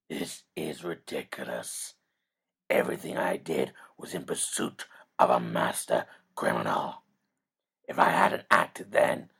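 a growling vigilante defending himself; gruff Batman. Acted. Batman protests to the lawyer in a low gravel growl.

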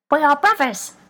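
two voices speaking as one; odd stereophonic voice. A narrator's reading. Talking feet answering her, odd doubled voice. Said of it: "it sounded odd, stereophonic".

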